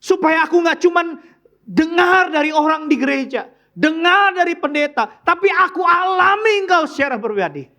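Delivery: high, loud pleading prayer that builds to a shout; crying out to God. Acted. Believer crying out to God for proof, building to a shout. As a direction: call out to God, rising to a shout. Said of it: "Saudara mesti ngomong sama Tuhan".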